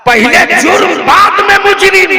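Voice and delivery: high, loud rally shout; shouted slogan. Real, not acted. Orator gives crowd the crime-first slogan, shouted.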